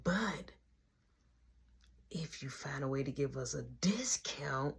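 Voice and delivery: hushed, low conspiratorial whisper. Retold or imitated. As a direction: whisper it so the boss can't hear. Brother whispering a scheme.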